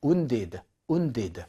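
"wounded" with a long oo vowel; wounded as "oon-did". Shown as a demonstration. Teacher recites the forms of injure, "wounded" as oon-did. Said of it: "이렇게 읽습니다".

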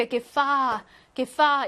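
파 stressed after an unstressed prefix; stress on second syllable. Shown as a demonstration. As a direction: lean on 파, not the syllable before it. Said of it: "뒤에 강세가 와서요". (접두어 뒤의 파에 강세)

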